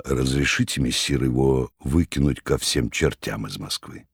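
low, soft deferential tone; respectful. Acted. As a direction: speak humbly to the master. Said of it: "добавил почтительно".

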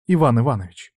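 cold and level, falling at the end; icy cold. A narrator's reading. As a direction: say the name flatly, keeping control. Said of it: "ледяной, как айсберг, голос Иры".

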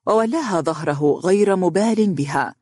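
ordinary delivery

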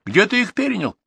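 high-pitched called question; calling out. A narrator's reading. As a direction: call the question over from afar. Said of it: "крикнул он".

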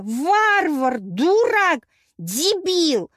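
high, loud hurled insults; furious wailing. A narrator's reading. Goddess cursing the man who broke branches, wailing fury. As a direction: shout each insult in distress.

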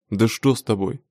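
ordinary delivery, nothing marked

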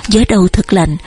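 usual speaking voice

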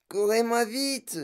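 high, slow plea, lightly out of breath; essoufflée. A narrator's reading. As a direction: beg them to slow down, mildly panting. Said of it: "haleta madame Salt". (voix aiguë, un peu haletante)

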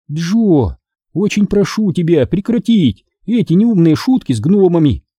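a stern, cross mother's reproach; mildly angry mom. A narrator's reading. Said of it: "сердито говорит мама".

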